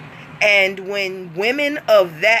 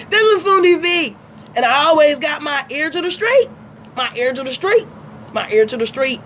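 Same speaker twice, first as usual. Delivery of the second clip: brash radio host persona; 6 semitones higher, faster than usual. Acted. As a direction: sell it like a street radio DJ. Fundi V persona again.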